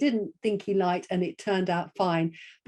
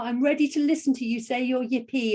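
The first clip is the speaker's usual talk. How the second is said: ordinary delivery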